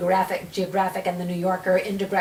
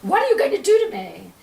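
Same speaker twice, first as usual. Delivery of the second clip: terrified; 7 semitones higher, 6 dB louder than usual. Retold or imitated. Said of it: "the patient looks at me terrified".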